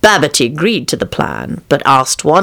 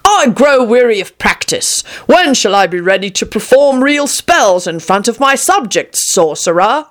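raised-pitch, demanding king; impatient king; 7 semitones higher than usual. A narrator's reading. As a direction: play a king tired of waiting.

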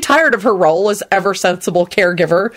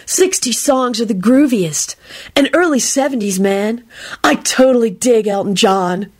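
laid-back, approving hippie; groovy hippie; 3 semitones higher than usual. A narrator's reading. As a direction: drift through it like a flower child. Hippie character approving of old songs.

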